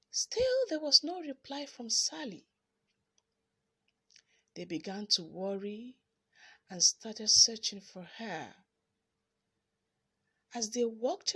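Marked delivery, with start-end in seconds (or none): none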